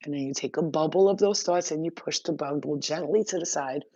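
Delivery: ordinary talk, nothing marked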